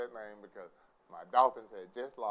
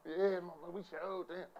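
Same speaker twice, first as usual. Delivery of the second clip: high, soft playful brag; young self boasting; 6 semitones higher, 8 dB quieter than usual. Retold or imitated. Young comic bragging to mama, high and playful. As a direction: pitch it up like a proud youngster. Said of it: "You know, I'm young".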